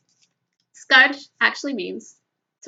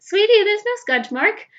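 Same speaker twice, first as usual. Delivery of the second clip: put-on higher, louder gentle voice to a child; reassuring; 9 semitones higher, 5 dB louder than usual. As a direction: tell the boy kindly, all is fine. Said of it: "And I went".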